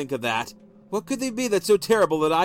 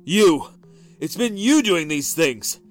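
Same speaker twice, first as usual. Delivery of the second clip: loud, slow furious yell; husband accusing wife, furious; furious accusation; 4 semitones higher, 7 dB louder, slower than usual. A narrator's reading. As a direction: hurl it at her.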